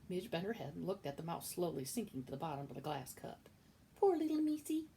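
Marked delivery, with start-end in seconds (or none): 4.0-4.9 high, louder put-on maid's voice; dim kitchen maid. Midge pitying the mouse in the oil, high put-on voice. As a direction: coo at the mouse in a simple maid's voice.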